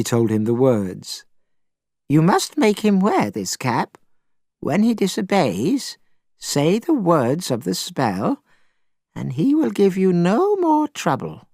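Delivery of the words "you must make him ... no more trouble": put-on old woman voice. A narrator's reading. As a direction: speak gently as a grieving old woman. Old woman gives the spell.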